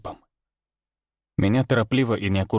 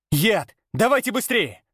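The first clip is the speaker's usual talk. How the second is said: urgent shout; 12 semitones higher than usual. A narrator's reading. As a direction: yell at them to hurry. Urgent call to hurry.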